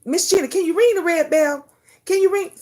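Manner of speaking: high loud asking voice; pleading request. Retold or imitated. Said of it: "just say".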